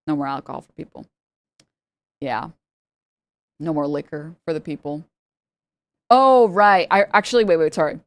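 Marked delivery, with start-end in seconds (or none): 0.0-5.0 softer, faster "No more alcohol for people" under the breath; quiet rueful repeat. Shown as a demonstration. Lamenting the lost open bar to a friend, quiet and rueful. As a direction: mutter "No more alcohol for people" as a wry aside.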